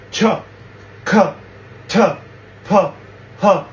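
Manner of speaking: Ch, k, t, p, h each with a puff of air; aspirated consonants. Shown as a demonstration. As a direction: push a breath out on each letter. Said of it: "say it with a puff of air".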